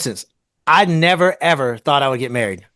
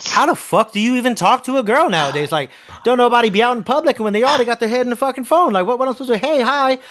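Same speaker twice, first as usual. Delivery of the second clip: exasperated rant; 8 semitones higher than usual. Retold or imitated. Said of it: "and I was like".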